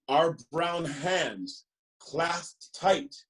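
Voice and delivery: lively, sing-song picture book reading; reading to children. Acted. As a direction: read it like story time for little ones.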